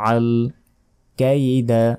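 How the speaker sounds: usual speaking voice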